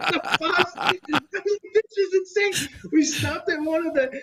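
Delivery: high voice breaking up with laughter; laughing while talking. Real, not acted. Telling the hosts about the bus, cracking up. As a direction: barely get it out through real laughter.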